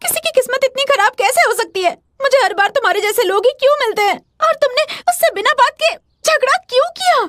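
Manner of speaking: high-pitched, aggrieved scolding; exasperated complaint. Acted. Girl blaming boy for the needless fight, exasperated.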